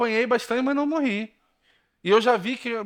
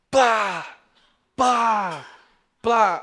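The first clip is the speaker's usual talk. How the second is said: door bang sound; 4 semitones higher, much slower than usual. Retold or imitated. Banging cabinet door.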